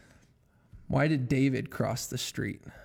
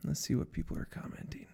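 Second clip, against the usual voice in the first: whispering; 9 semitones lower, 11 dB quieter than usual. Real, not acted. Whispering to himself.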